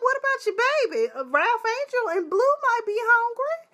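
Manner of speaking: high squeaky mock of Bob's pleading. Mocking Bob begging Aunt Vi for mac and cheese, high. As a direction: whine it up high, poking fun. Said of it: "Bob was like".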